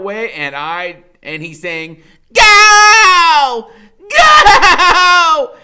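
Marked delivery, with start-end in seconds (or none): 2.3-5.6 very high, very loud yell; urgent shout. A narrator's reading. Freak yelling at his friend to run, frantic shout. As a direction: scream at him to run now.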